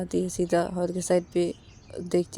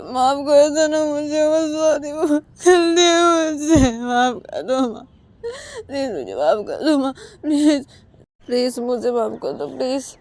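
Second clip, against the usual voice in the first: crying plea; 8 semitones higher, 9 dB louder than usual. Acted. Sanju, crying, begs Uma for forgiveness. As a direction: beg her through tears. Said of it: "ऐसे रोते रोते संजू".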